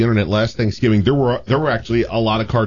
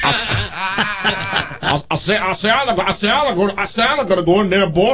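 higher, stuttery cartoon rooster; Foghorn Leghorn; 7 semitones higher than usual. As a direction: sputter each phrase like a blustery rooster. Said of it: "That's Foghorn Leghorn".

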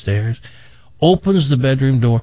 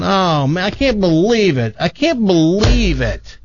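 high, drawn-out joke despair; hammed-up dismay; 7 semitones higher than usual. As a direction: wail mildly in mock disbelief. Husband despairs over coming divorce.

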